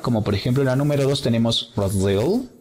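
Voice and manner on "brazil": "Brazil" stressed on ZIL; last-syllable stress. Shown as a demonstration. Teacher reading out examples, "Brazil" accented on last syllable. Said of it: "el acento en la última sílaba".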